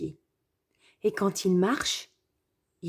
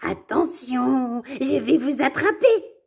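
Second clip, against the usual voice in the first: raised, slow mock threat; playful shout; 6 semitones higher, 4 dB louder, slower than usual. Wolf playfully calls out a threat to his friends. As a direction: call it out as a game. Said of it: "Puis il crie".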